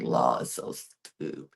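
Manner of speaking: whispered fond aside. Retold or imitated. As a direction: breathe it as a playful secret. Fond quip about judge.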